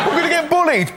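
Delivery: a frightened schoolgirl on her first day; little girl voice. Retold or imitated. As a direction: squeak it like a worried little girl. Voicing scared little girl.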